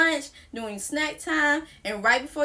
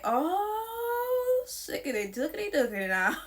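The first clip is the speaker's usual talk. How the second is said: silly bliss; 4 semitones higher, 4 dB quieter, slower than usual. Retold or imitated. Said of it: "I was like".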